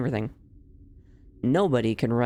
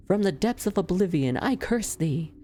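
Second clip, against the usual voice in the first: higher, grand villainess curse; dramatic villain curse; 6 semitones higher than usual. Pretend. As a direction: declaim it like an ominous villainess. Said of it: "say something impressive".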